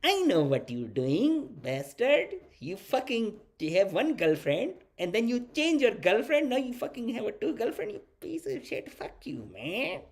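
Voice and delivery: high-pitched put-on accented dad voice; mad, proud dad. Buddy's dad massaging him while scolding, mock-mad and proud. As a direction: imitate a father scolding with secret pride. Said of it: "kinda mad, kinda proud".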